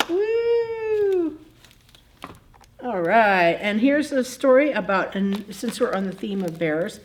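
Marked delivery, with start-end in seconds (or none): 0.1-1.4 cheering. Real, not acted. Cheering after rating the book.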